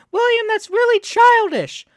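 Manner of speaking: put-on high, loud whiny parody of a scolding girl; mocking Violet. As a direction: mimic her scolding in a squeaky whine. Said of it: "she's like".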